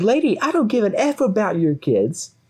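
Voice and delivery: high-pitched retold snap back; mock angry dismissal. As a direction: imitate a belligerent man brushing off a complaint. Redneck turns on the mother.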